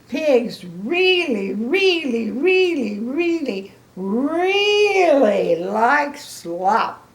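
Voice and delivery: each really rising, slow and drawn out; stress on really. Shown as a demonstration. Grandma stressing to kids how much pigs love slop. Emphatic repetition.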